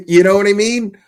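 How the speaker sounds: ordinary talk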